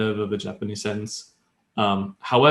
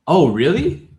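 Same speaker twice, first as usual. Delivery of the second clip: higher, louder questioning "Oh, really?"; interested surprise; 6 semitones higher, 6 dB louder, slower than usual. Shown as a demonstration. Teacher showing how to want more information, interested surprise. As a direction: lift it sharply, asking for more. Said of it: "implying you want more information".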